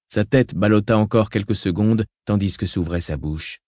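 ordinary delivery, nothing marked

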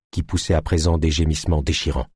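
usual speaking voice

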